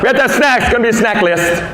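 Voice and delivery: a hint of a high, insistent mom; pushy soccer mom. Mom chasing him in the parking lot, mildly high and insistent. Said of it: "This mom ran me down".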